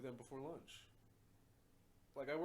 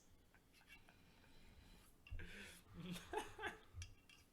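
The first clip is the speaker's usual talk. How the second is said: laughing; 8 semitones higher, 4 dB quieter than usual. Real, not acted. Laughing at own joke.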